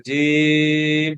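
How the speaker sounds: জিম stretched far out, held slow and steady; very long. Shown as a demonstration. Teacher contrasting the long জিম with short letters, stretched out. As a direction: draw জিম out as long as a breath allows. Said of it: "অনেক লম্বা করে।".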